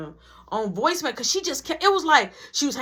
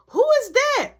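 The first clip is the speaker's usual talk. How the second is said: high, loud imitation of a nosy onlooker; shouted demand; 14 semitones higher, 6 dB louder than usual. Kayla demands to know who the girl is, shouting. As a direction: pitch it way up and holler. Kayla asks about the stranger.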